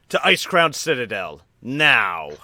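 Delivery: loud, high shouted order; battle command. Acted. Lorthamar pointing his sword to lead the charge, loud command. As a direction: charge them forward. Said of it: "unsheathes his sword and points".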